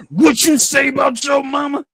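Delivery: high, loud furious shout; an angry father storming in, yelling at his son; father's angry yell. Retold or imitated. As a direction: bellow it like an enraged dad. Father bursts through door yelling.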